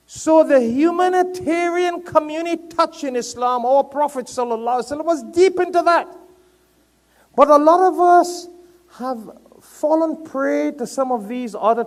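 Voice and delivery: high, loud sermon voice; impassioned preaching. Real, not acted. Preacher telling of the Prophet's community touch, high and impassioned.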